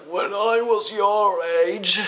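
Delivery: high, drawn-out old-timer impression; mock old codger, high and slow; old man voice. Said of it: "have that old voice".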